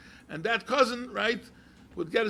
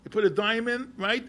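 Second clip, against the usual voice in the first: usual speaking voice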